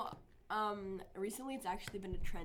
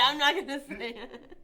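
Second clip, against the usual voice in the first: loud, slow, laughing words; real laughter breaking into the words; laughing refusal; 3 semitones higher, 10 dB louder, slower than usual. Real, not acted. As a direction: refuse while cracking up. Cracks up, refuses to answer.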